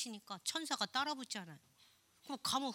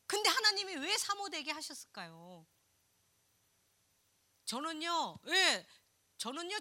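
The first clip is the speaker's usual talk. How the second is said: ordinary talk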